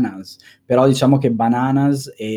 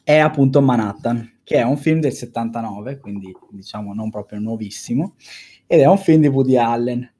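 ordinary talk, nothing marked

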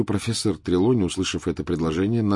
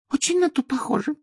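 Trelawney voice. Acted.

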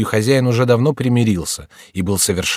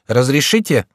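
higher-pitched, sharp woman's voice; резкий женский голос; 4 semitones higher than usual. A narrator's reading. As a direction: ask sharply, as a woman caller. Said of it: "резкий женский голос спросил". (резким женским голосом)

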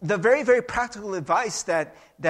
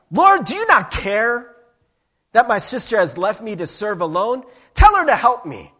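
high, loud, indignant demand; yelling; 3 semitones higher, 9 dB louder than usual. Acted. Martha demanding Jesus make her sister help, acted yelling. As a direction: yell it in outrage, punching each phrase. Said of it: "She is yelling at Jesus".

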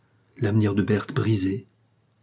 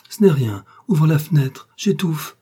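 higher, urgent plea for air; suffocating distress; 6 semitones higher, 5 dB louder than usual. A narrator's reading. Poisoned wife asks for open window, distressed. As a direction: beg for air, voice raised.

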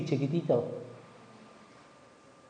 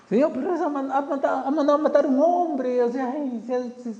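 higher, incredulous complaint; indignant protest; 8 semitones higher than usual. Acted. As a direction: protest in disbelief. Prophet protesting to God.

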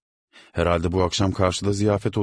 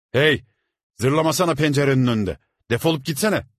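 loud, high roar; roaring shout; 9 semitones higher, 6 dB louder than usual. A narrator's reading. Landlord roaring at the crying boy below. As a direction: bellow down from the window. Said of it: "gürler gibi bağırdı".

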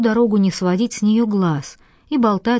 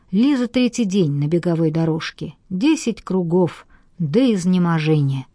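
ordinary delivery

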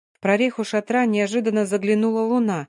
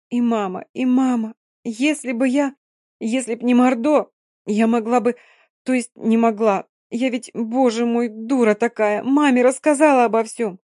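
put-on grieving girl, building to despair; 4 semitones higher, 4 dB louder than usual. Dina's tearful confession continues.

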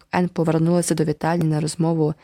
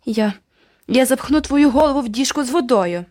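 bellowing; 6 semitones higher than usual. A narrator's reading. Boy yelling threats at the girl. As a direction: roar it in rage. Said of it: "загорлав Джеральд".

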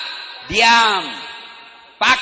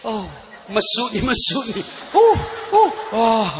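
tiruan ibu hamil. Acted. Menirukan ibu hamil susah berjalan.

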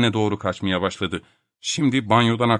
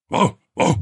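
high, put-on double bark; imitated dog bark; 7 semitones higher, 3 dB louder than usual. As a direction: bark back through the door. Topak barks from inside the bathroom. (tiz sesle havlama)

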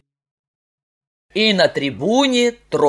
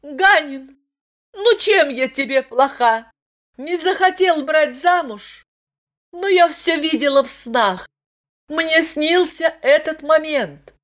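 sad; 8 semitones higher than usual. Acted. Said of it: "Катя грустно сказала".

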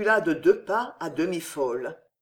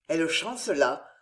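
usual speaking voice

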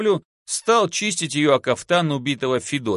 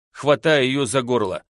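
usual speaking voice

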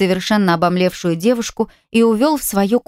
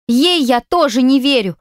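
stubborn; 6 semitones higher than usual. A narrator's reading. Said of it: "упрямо заявила Флора".

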